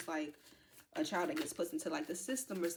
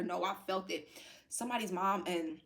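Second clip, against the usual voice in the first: ordinary talk, nothing marked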